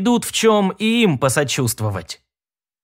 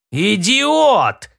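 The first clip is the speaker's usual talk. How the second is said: sharp furious shout; angry yell; 5 semitones higher than usual. A narrator's reading. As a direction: bellow the insult at him. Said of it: "заорал начальник". (злой крик начальника)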